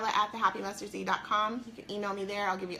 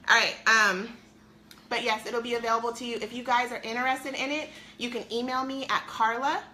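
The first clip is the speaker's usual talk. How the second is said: normal talking voice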